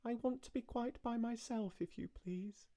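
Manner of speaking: put-on high, soft, prim little girl; small polite voice through a door; a child quietly refusing, very high and hushed; queer polite little voice. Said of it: "said a queer, polite little voice".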